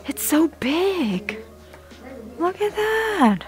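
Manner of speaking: real amazement at how big it is; excited squeal. Real, not acted. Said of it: "I got so excited".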